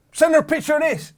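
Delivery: high, eager urging; excited. Retold or imitated. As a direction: egg him on, thrilled. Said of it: "He goes".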